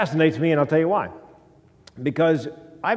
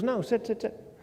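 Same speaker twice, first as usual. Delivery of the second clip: higher, softer voice for the woman; imitated eager invitation; 8 semitones higher, 6 dB quieter than usual. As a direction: lightly imitate her waving you into a seat. Woman inviting him to sit.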